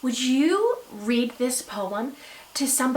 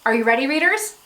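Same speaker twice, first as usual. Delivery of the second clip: ordinary talk, nothing marked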